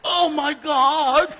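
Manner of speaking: loud, high, slow laughing exclaim; real laughter at his own story. Real, not acted. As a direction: crack up at the punchline. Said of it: "speaker laughing at his story".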